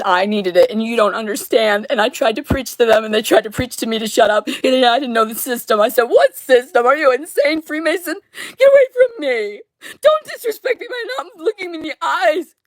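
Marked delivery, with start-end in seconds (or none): 6.0-12.5 shrill, much higher shriek breaking between laugh and sob; crying outburst. Real, not acted. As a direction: scream it at them, half laughing, half sobbing.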